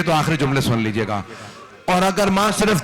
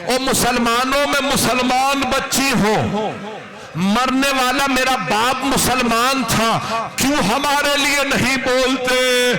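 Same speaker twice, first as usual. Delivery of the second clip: put-on child crying; 7 semitones higher than usual. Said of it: "ये बच्ची रो रो के कह रही है".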